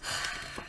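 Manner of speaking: acted long breath out; puffed storybook exhale; heavy breathing. A narrator's reading. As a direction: breathe out audibly, as if winded. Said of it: "breathing very loudly".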